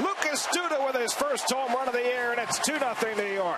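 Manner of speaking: excited rising home run call, shouting. Real, not acted. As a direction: call the homer at full cry.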